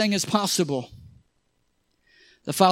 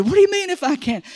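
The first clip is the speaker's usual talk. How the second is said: high, quick incredulous question; half-amused, half-offended Jesus asking back; concerned; 10 semitones higher, faster than usual. Retold or imitated. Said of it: "kinda getting a little bit concerned here".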